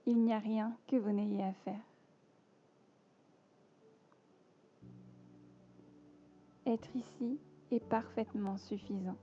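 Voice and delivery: measured yoga nidra voice with long pauses between phrases; guided meditation. Acted. Teacher guiding listeners lying down into yoga nidra, calm meditation voice.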